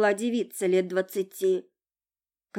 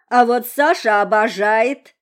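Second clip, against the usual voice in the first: loud, slow tipsy tittering; drunk giggling voice; 3 semitones higher, 10 dB louder than usual. A narrator's reading. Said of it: "хихикнула девица".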